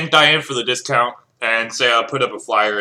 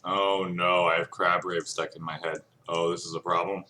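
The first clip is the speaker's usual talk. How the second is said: quiet aside; 6 semitones lower, 10 dB quieter than usual. Real, not acted. Player complains about a song in his head, very soft and low. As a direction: lower and much softer, half to himself.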